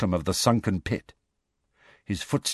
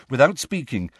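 usual speaking voice

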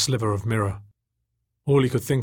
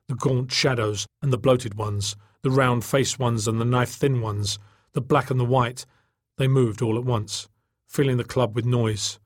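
plain reading voice

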